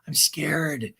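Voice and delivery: breathy mock-scared whisper. [name] whispering her fear to Jenna. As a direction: drop to a scared whisper on the repeat.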